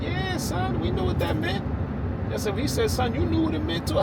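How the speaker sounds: high voice for his dad; dad's voice. Retold or imitated. Dad to son after leaving the store, high knowing voice.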